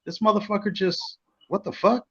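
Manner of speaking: high, hushed disbelief; thrown off. Retold or imitated. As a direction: retell being stunned, high and soft. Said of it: "I was so thrown off by it".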